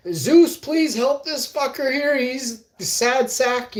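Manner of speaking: high, drawn-out plea; mock prayer. Praying to Zeus for a troublemaker, mock pleading. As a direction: plead slowly to the heavens.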